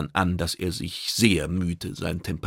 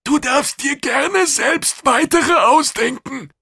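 very high, loud, slow creature voice; uncynical alien official; 8 dB louder, slower than usual. A narrator's reading. Kidang inviting others to invent reasons, high and earnest. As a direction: offer it loudly and slowly, in earnest. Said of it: "Es klang nicht einmal zynisch".

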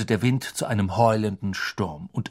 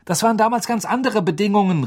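fast, louder agitated cry; exclaiming; 9 semitones higher, 5 dB louder, faster than usual. A narrator's reading. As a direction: burst out, eyes bulging. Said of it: "rief Wood mit leicht hervorquellenden Augen".

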